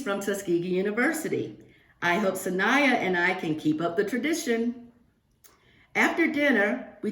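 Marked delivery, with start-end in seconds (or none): none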